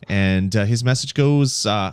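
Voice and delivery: normal talking voice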